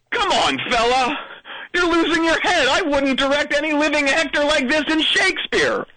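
put-on a touch of a grand old actor lecturing; pompous old director. Kid scolding the comedian like a stage director, theatrical.

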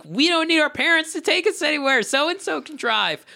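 put-on excited teen boast. Podcaster recalling high school summer of new licences, high excited mock boast. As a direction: crow it like a kid newly set free. Voicing newly mobile teenage self.

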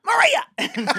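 very high, loud squawk; sudden mock outburst. Guest re-enacting a practice joke with a friend, high shrieked outburst. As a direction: blurt the name in a high shriek. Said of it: "we were like".

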